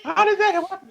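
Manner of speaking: very high, squeaky incredulity; mock disbelief. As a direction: demand an explanation in a hammed-up high voice. Acting out disbelief at actor's nationality.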